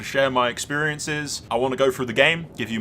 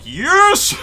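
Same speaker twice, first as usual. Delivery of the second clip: triumphant cheer; 8 dB louder than usual. Retold or imitated. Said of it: "I was like".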